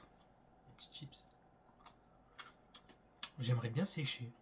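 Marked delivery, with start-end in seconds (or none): 0.0-1.2 whisper. Real, not acted. Murmured while eating.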